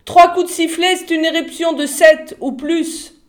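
loud, high, urgent cry; shouted warning. A narrator's reading. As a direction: call out the danger at the top of your voice. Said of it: "cria Jeeves".

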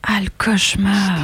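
hushed spooky whisper. Acted. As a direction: breathe it like a ghost story. Spooky aside about a nightmare.

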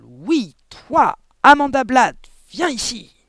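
loud, high, drawn-out summons; thundering. A narrator's reading. Headmistress orders Amanda to come over, thundering. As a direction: bellow the order imperiously. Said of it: "tonna-t-elle".

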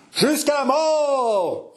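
high, drawn-out battle cry; captains' roar. Acted. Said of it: "rugirent les capitaines". (rugissement guerrier des capitaines)

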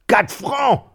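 high, loud indignant cry; outraged shout. A narrator's reading. Miserly widow outraged at the well-digger's price, loud high cry. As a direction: shriek it in disbelief.

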